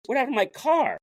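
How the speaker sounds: high, startled retold question; shocked question. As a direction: ask it in sudden disbelief. Retelling coach asking about car.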